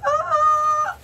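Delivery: high, loud held squeal; excited squeal. Real, not acted. About to show viewers the box, excited squeal.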